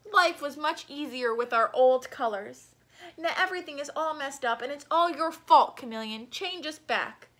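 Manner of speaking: loud angry mob; angry crowd. Acted. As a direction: blame him all at once. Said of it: "They rushed towards him angrily".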